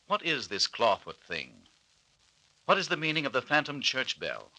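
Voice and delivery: ordinary talk, nothing marked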